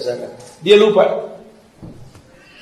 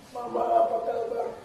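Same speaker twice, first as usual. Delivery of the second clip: put-on much softer, tender call of a little child; soft child's greeting; 4 semitones higher, 12 dB quieter than usual. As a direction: say it gently as the child once did. Child greeting mother, retold. (sapaan anak kecil yang lembut)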